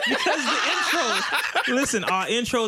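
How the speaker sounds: high, laughing through words; giggling while explaining. Real, not acted. Laughing after being teased.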